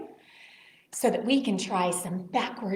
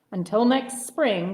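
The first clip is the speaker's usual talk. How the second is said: ordinary delivery, nothing marked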